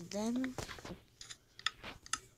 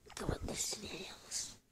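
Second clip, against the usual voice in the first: whisper; 6 dB quieter than usual. Real, not acted. Quiet aside while playing.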